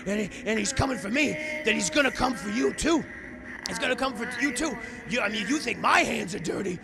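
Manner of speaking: building to a loud, high shout; acted frantic gangster rant; desperate warning. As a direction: plead and accuse with mounting panic. Gangster arguing, growing frantic.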